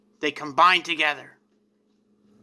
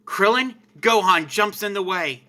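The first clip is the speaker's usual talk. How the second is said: usual speaking voice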